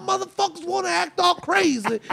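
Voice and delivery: very high, shouted furious tirade; angry scolding. Retold or imitated. Uncle scolding everyone after slamming door, furious. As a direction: tear into them for the noise.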